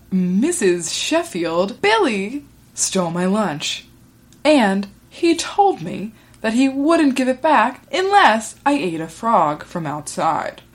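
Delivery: slow, over-emphasized mock anchor delivery; kid with newscaster voice. Kid reporting a stolen lunch to the teacher, mock newscaster voice. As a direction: report it like a tiny news anchor. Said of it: "hunting kids that talk like that".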